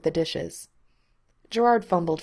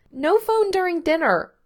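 put-on a little girl's bossy scold, much higher and louder; child scolding; 12 semitones higher, 6 dB louder than usual. A narrator's reading. Bandit scolding her dad over his phone at dinner, in a bossy child voice. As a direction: scold like a strict little kid laying down the rule. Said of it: "she scolded".

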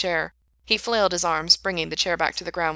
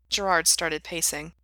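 ordinary delivery

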